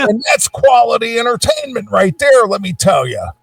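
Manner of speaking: high shouting cranky geezer; old man rant. Retold or imitated. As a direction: lecture like a grumpy old man. Continuing the mock rant.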